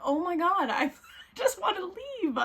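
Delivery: high-pitched, whiny mock exasperation; exasperated plea. Retold girlfriend begging her boyfriend to go, exasperated. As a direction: plead to go, pitched well up.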